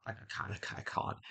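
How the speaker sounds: breathless whisper of defeat; embarrassed whisper. Real, not acted. Giving up on reading the Japanese title, whispered.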